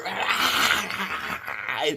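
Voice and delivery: long drawn-out attacking growl; imitated dog snarl. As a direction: mimic a dog clamping down and snarling.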